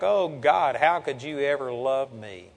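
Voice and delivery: low, soft, dejected plea; retold unworthiness. Preacher recalling feeling ungodly before God, quietly dejected. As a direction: sigh out a recalled prayer of self-reproach. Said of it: "I just was feeling so ungodly".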